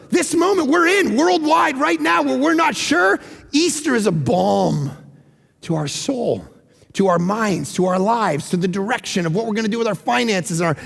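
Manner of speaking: usual speaking voice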